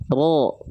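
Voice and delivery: low heavy "Ro" with O-like vowel and no pout; thick ro, unpouted. Shown as a demonstration. Teacher has students watch the mouth, thick unpouted ro. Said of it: "Mulut kita nggak monyong".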